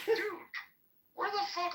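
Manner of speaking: high, incredulous cry of dude; mock outrage at the clueless staff; exasperated disbelief. Retelling how he snapped at the worker, high and incredulous.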